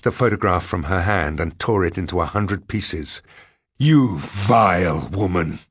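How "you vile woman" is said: slow, weighty contempt; angry denunciation. A narrator's reading. Man denouncing the woman he loathes, angry. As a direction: lean hard and slowly on the insult. Narrator's acted furious denunciation.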